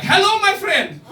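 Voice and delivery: high, loud, over-friendly shop greeting; hammed-up welcome to a customer; eager new shop guy. New cafe worker greeting the comedian, high and eager. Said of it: "he was like".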